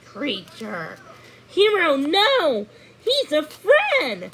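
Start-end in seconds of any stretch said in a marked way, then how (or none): 1.5-4.3 much higher, louder, slow call to the dog; urgent high shout; crying out. Acted. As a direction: stop the dog in alarm. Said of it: "Thumbelina cried".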